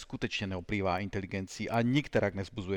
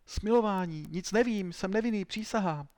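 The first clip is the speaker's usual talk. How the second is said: high-pitched wailing plea for mercy; pleading; 9 semitones higher than usual. Acted. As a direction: beg desperately as a beaten suspect. Beaten suspect begs for mercy.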